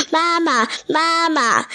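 little tadpoles hailing their mother; calling for mama. A narrator's reading. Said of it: "就一向前去叫".

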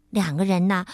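usual speaking voice

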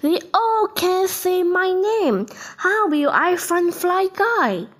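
high, anxious question; worried cry. A narrator's reading. Buzz unsure which fly is his, high worried cry. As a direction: pitch it high with worry. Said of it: "cried Buzz".

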